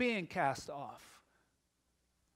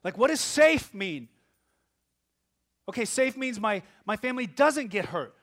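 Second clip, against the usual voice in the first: loud, high, impassioned sermon voice; full-force preaching; 7 semitones higher, 12 dB louder than usual. Real, not acted. Pastor preaching to the congregation, loud and impassioned. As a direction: preach it out with rising fire.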